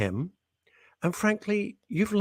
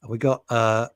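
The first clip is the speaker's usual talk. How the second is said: ordinary talk, nothing marked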